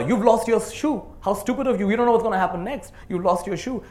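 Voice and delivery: high, loud, furious scolding of a little brother; retold scream. Girl on the train screams at her little brother, furious. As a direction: yell at him for losing his shoe. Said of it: "And she screams at her brother".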